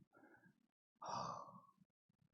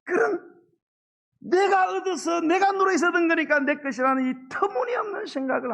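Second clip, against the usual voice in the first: loud, high, fervent sermon delivery; full-force preaching; 12 semitones higher, 9 dB louder than usual. Acted. (목소리를 높여 힘차게 설교하듯)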